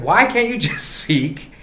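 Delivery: high, drawn-out imitation of pleading; retold host's insistent urging; mock exasperated plea. Retells Letterman urging Elba to speak normally.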